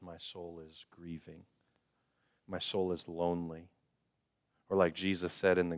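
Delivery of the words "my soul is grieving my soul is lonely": grieving confession. Shown as a demonstration. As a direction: say it as a quiet admission of grief. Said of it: "what you need to say is".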